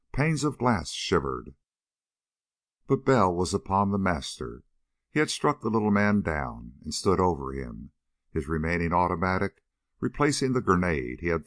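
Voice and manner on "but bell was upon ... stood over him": ordinary delivery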